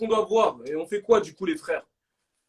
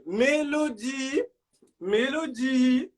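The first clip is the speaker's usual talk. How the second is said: slow drawn-out sing-song call; slow sing-song call for Mélodie; 5 semitones higher, 4 dB quieter, much slower than usual. Real, not acted. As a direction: stretch her name out calling. Calling out her name slowly.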